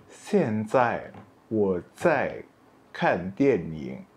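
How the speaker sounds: very slow, careful Mandarin; slow classroom demo; slow model sentence. Shown as a demonstration. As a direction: spell it out slowly for learners. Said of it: "Jadi seperti ini bilangnya".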